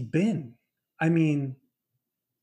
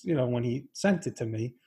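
normal talking voice

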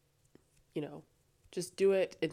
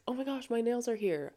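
excited; 8 semitones higher than usual. Retold or imitated. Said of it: "you're like".

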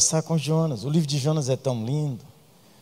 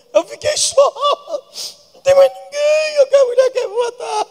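whiny Elijah; 4 dB louder than usual. Acted. Mocking Elijah's complaint.

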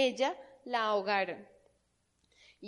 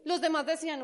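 ordinary talk

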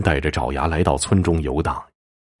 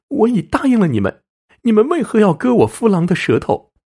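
very high, put-on woman's wail; anguished cry; 13 semitones higher, 4 dB louder than usual. A narrator's reading. Wife protests her husband's mutilation, anguished high cry. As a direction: cry out in horror, voice pitched way up.

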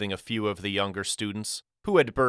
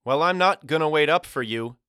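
put-on raised, higher boy's voice; calling back; 6 semitones higher, 4 dB louder than usual. A narrator's reading. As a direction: holler a warning to your brother at a distance. Brothers shouting across the schoolyard.

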